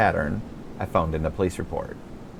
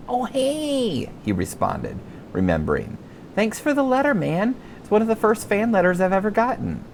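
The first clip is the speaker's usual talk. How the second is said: put-on higher, boyish and friendly voice; young star voice; 12 semitones higher than usual. Thirteen-year-old TV star chatting with a fan, higher boyish voice.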